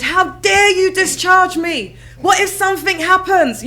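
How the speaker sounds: high, loud, slow mock indignation with a laugh; shocked outrage. Telling off the neurologist's secretary, mock outrage. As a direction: play the outraged patient, half laughing. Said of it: "I was just so shocked".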